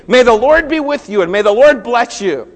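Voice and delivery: loud, high, booming office hello; hearty greeting. Pretend. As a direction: call a blessing out to the whole workplace. Imagined loud workplace morning greeting.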